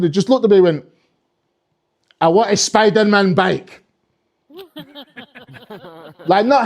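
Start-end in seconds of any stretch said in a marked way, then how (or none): 2.2-3.8 put-on slow, deadpan Scottish kid; Scottish accent. Scottish kid staring at Santa, blunt Scottish demand. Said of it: "this one Scottish kid".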